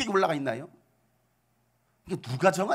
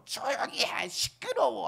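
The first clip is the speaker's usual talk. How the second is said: high, sharp shushing scolding; 6 semitones higher, slower than usual. Retold or imitated. Crowd rebuking shouting Bartimaeus, mock scolding. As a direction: imitate a crowd telling the beggar off. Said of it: "꾸짖기 시작하는 거예요".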